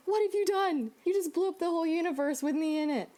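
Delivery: high, loud and fast, half-mock panic; replaying the moment of horror at her friend; panicked outburst. Panicked cry at friend who sent the text.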